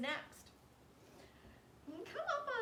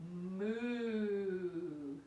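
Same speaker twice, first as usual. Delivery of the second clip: low, louder moo; cow moo; 4 semitones lower, 4 dB louder than usual. Shown as a demonstration. Teacher leading cow pose, low moo. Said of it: "Let's try it again together".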